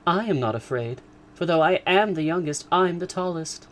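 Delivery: put-on high, bold young girl; stoutly. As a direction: boast confidently. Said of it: "said Lydia stoutly".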